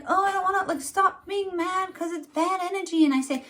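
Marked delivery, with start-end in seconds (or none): 0.0-0.7 mock meek. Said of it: "And, like". 0.8-3.0 mock preachy.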